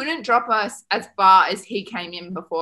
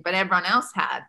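ordinary delivery

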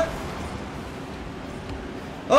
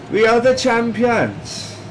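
put-on Welsh accent; 7 semitones higher, slower than usual. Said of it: "Doing my best Welsh accent".

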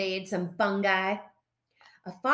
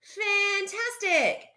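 high, drawn-out fake enthusiasm; fibbing; 11 semitones higher, slower than usual. A narrator's reading. Foxes lying to Fosdyke about hunting, fibbing. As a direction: stretch it out too brightly to be true. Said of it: "they fibbed".

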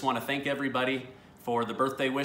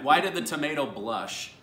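ordinary talk, nothing marked

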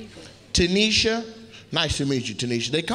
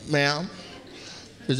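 ordinary talk, nothing marked

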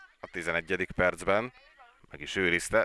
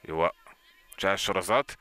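ordinary talk

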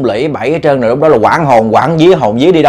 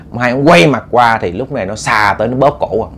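plain reading voice

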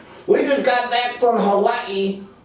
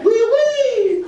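high squeaky French oui oui; mock French; 12 semitones higher than usual. Mocking the French for the crowd, squeaky mock French. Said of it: "they say that all the time".

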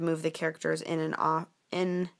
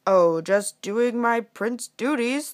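slow, louder, self-assured prince voice; storybook prince; 3 semitones higher, 6 dB louder, much slower than usual. Acted. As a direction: shrug off praise as the hero. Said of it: "the prince said".

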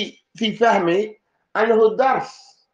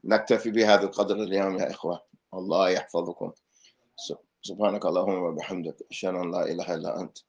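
much softer, lower, fast murmured closing dua; حصة تنتهي بدعاء خافت سريع; closing prayer; 13 semitones lower, 9 dB quieter, much faster than usual. Acted. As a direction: rattle off the closing supplication quietly. Closing supplication of the lesson.

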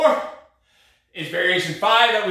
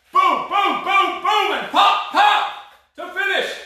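hyped coach shout; 11 semitones higher, slower than usual. Real, not acted. Hyped coach drives last punches.

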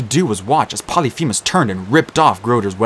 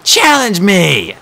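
higher, louder, slow challenge; defiant shout; 9 semitones higher, 6 dB louder, much slower than usual. A narrator's reading. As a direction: yell it up at the monster. Captive girl defying the monster.

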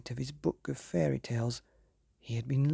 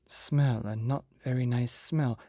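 plain reading voice

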